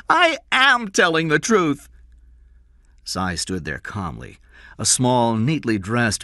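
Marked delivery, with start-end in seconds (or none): 0.1-1.9 put-on very high, loud child's protest; insistent boy. A narrator's reading. Jeff answers the demand for truth, insistent. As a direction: insist on AM in a boyish pitch.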